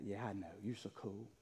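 very soft, low sarcastic aside; mock agreement. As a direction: drop low and humour them drily. Mocks the too-cool sinner.

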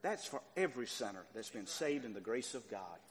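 ordinary talk, nothing marked

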